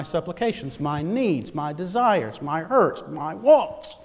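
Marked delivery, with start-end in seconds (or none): none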